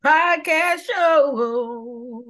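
big drawn-out welcome to the show; show intro announcer. Acted. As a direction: announce the podcast like a host.

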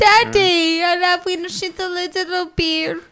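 childlike mock-German plea; German accent. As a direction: call for daddy in comic German. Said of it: "What's the German?".

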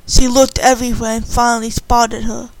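usual speaking voice

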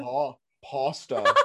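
"pasta" slowly with a drawn-out ah; imitated pronunciation. Shown as a demonstration. Mocking the other's pasta, slow PAAH-stuh. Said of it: "I'm sorry I didn't say pasta".

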